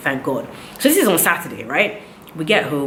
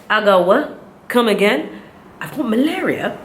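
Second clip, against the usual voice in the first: higher-pitched incredulous questioning; retold disbelief; 6 semitones higher than usual. Patient retelling her reaction to malaria diagnosis, incredulous. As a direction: re-enact stunned disbelief at bad news.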